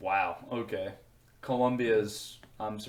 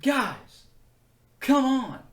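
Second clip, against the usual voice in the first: exasperated high plea; 7 dB louder, much slower than usual. Real, not acted. Exasperated at the crime ranking.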